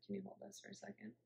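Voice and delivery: quick, soft aside off-mic; quiet aside. Real, not acted. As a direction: mutter it quickly to someone nearby.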